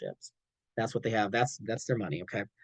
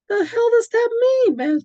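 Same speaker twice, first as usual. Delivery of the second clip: offended outburst; 9 dB louder than usual. Acted. Reply to a passive-aggressive compliment.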